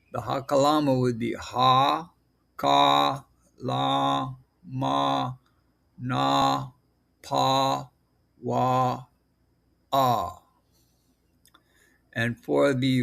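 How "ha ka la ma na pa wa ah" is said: "ha, ka, la, ma, na, pa, wa, ah" each held long and set apart; syllable demonstration. Shown as a demonstration. Teacher showing how the hakalama is pronounced, drawn-out separate syllables. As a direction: sound out "ha, ka, la" one level syllable at a time. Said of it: "this is how it will be pronounced".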